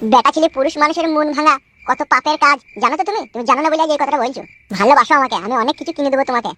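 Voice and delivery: normal talking voice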